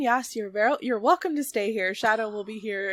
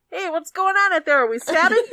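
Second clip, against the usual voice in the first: muffled voice; 6 semitones higher, 5 dB louder than usual. Acted. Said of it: "You hear a muffled voice say".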